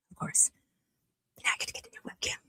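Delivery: sly whispered aside. Real, not acted. Streamer confiding to viewers about the webcam, sly whisper. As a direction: whisper it like a little secret. Secret aside after being "responsible.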